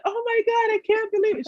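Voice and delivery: very high-pitched overjoyed squeal, acted out; retold giddy excitement; imitating excited client. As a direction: play her thrilled disbelief way up high. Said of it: "she was, like, so excited".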